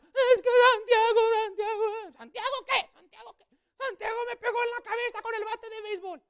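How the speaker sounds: put-on very high, loud wailing girl; crying sister. Sister crying to grandma about the bat, high and loud. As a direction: wail it like a sobbing kid. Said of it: "mi hermana llorando".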